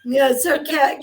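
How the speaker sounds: normal talking voice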